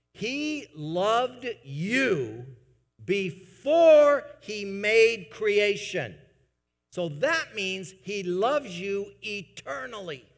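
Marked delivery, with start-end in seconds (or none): 0.1-6.2 very slow and drawn out, rising to loud on before; slow emphatic preaching. Real, not acted. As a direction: lean hard on each word, stretching it out. Preacher drives home God's love.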